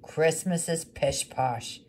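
gruff woodcarver before shutting the door; gruffly. Acted. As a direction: dismiss Christmas with contempt. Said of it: "said Jonathan gruffly".

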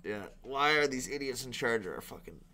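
normal talking voice

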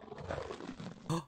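a quick, airy, startled gasp; sharp gasp. Real, not acted. Gamer sees a mob nearby, sharp startled gasp. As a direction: suck in air at the shock. Gasps at danger in game.

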